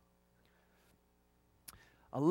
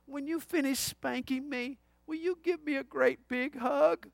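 put-on soft, high little-boy voice; quivering little voice; 6 semitones higher, 5 dB quieter than usual. Five-year-old asking his dad for a hug, quivering little voice. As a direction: imitate a small child pleading, higher and softer. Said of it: "quivering little voice".

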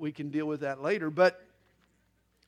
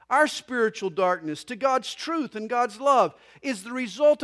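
emphatic, raised pulpit voice; preaching; 6 semitones higher, 7 dB louder than usual. Real, not acted. Pastor preaching on faith and unbelief, emphatic preaching. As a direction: press the point on the congregation.

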